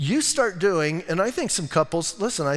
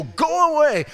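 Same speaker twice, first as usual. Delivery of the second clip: sharp, much higher, louder "go away"; acted rebuke; 10 semitones higher, 6 dB louder, slower than usual. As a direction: bark it at the devil. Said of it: "you go".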